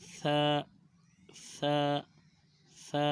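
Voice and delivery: "ثا" with tongue out between the teeth; lisped tha. Shown as a demonstration. Teacher models tongue placement, lisped "ثا". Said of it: "دانتوں سے باہر ذرا منہ سے نکال".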